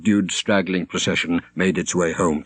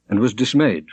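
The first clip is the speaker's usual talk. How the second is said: ordinary delivery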